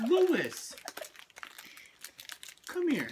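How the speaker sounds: loud, high holler; calling the dog. Real, not acted. Owner summons the wandering dog, loud high call. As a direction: shout for the dog to come.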